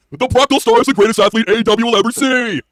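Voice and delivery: mock hype announcer. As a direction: ham up the praise like a ring announcer.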